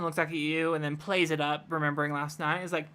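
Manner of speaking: ordinary delivery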